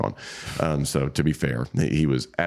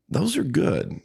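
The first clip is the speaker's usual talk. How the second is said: high, approving praise; high-pitched retold quote; slower than usual. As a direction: voice leaps up, approving. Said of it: "He goes".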